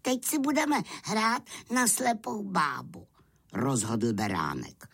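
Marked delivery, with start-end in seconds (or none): 0.0-3.1 high-pitched, decisive lamb voice; bossy little lamb. Acted. Lamb decides the next game. (rozhodný beránek vysokým hláskem)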